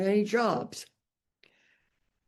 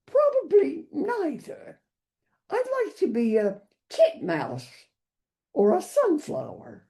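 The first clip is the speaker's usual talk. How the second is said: much higher, snooty European elite lady; 10 semitones higher than usual. Acted. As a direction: look down your nose, pitch way up. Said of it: "the high-pitched, snooty voice of the European elite".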